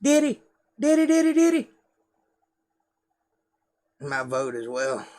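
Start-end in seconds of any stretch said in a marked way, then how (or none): 0.0-1.7 very high-pitched mock little Southern kid. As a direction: imitate yourself as a child calling out for daddy. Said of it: "tapes of me when I was a kid".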